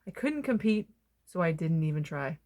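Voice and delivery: usual speaking voice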